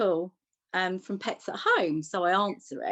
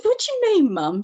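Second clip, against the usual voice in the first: much higher and louder, incredulous; shocked; 11 semitones higher, 9 dB louder than usual. Retold or imitated. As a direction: blurt it out in disbelief at mum. Mum says it's not dad.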